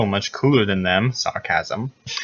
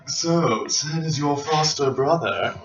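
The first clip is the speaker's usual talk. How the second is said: quiet character voice; 4 semitones higher, 5 dB quieter, slower than usual. Acted. Voicing Sephiroth in story.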